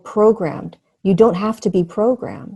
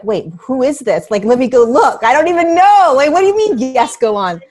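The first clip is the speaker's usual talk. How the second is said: mock-baffled protest, climbing high and loud; incredulous retelling; 6 semitones higher, 9 dB louder than usual. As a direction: act out startled disbelief, building to loud, high exclamations. Acts out startled reply to guide.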